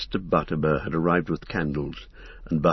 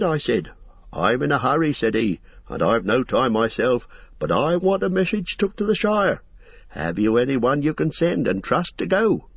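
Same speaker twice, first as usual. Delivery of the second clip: fussy innkeeper; 8 semitones higher than usual. Acted. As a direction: chatter on like a busy, apologetic landlord. Landlord voiced in the story.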